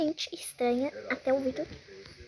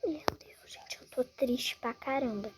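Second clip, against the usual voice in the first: whisper; 2 semitones lower, 6 dB quieter than usual. Real, not acted. Sad at dying in game.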